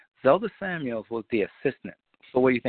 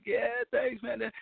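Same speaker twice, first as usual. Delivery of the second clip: lightly voiced singer, much higher, offhand; casual singer; 12 semitones higher, 6 dB quieter, slower than usual. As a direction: toss off the thanks in a higher voice. Singer replying to manager.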